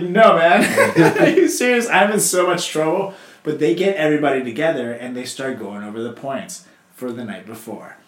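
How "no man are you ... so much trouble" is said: higher, louder, laughing through it; laughing refusal. Retold or imitated. Turning down the drunk brother, laughing. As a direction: refuse while cracking up. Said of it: "I was like".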